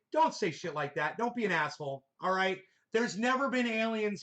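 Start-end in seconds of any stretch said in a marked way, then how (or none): none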